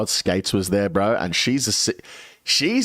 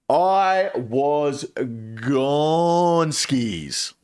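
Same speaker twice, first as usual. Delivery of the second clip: drawn-out stoned; 2 semitones higher, much slower than usual. Real, not acted.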